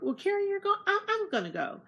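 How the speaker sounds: high, bright mock Glennis voice; excited agreement. Glennis agreeing to dinner once she hears Karen is going, excited. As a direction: imitate Glennis perking up and agreeing. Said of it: "And Glennis is like".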